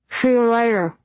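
muffled by bread in mouth; talking through a stuffed mouth; mouth full. A narrator's reading. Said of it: "muffled by the baguette that was stuffed in his mouth".

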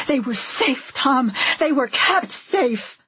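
pleading anger; heated insistence. A narrator's reading. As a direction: insist on it, drawing out the last phrase. Husband and wife in acted quarrel.

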